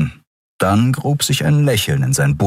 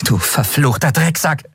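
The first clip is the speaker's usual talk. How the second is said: angry cursing; 8 semitones higher than usual. Acted. Furious Endriel curses the skeleton.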